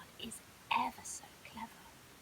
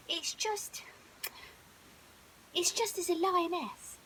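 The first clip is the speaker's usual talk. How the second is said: shy lion cub; 5 semitones higher, 5 dB louder than usual. Acted. Leo shy.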